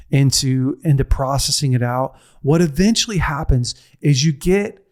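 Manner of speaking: normal talking voice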